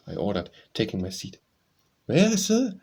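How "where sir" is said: high, loud startled question; taken aback, voice leaping higher; frank surprise. A narrator's reading. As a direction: shoot the pitch up in surprise. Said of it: "he demanded with frank surprise".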